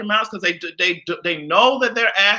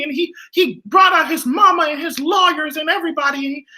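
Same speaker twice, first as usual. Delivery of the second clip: mocking Goofy voice; 11 semitones higher than usual. Mocking Khloe's Instagram statement in a high Goofy voice. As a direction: squeak it out like a silly cartoon dog. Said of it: "sounded like Goofy".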